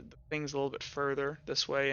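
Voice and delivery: usual speaking voice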